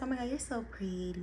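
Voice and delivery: soft, high gushing coo; adoring self-compliment. Acted. You just woke up and admire yourself, soft high coo. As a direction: coo it softly, a little high.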